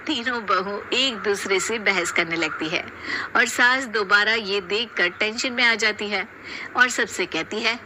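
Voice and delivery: usual speaking voice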